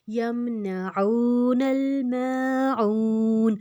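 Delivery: slow یمنعون الماعون with "oo" and "aa" held long; long vowels. Shown as a demonstration. Teacher reads a long joined word, drawn-out vowels in یمنعون الماعون. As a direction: stretch each long vowel two beats in یمنعون الماعون. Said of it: "ہم اس کو دو حرکت لمبا کریں گے".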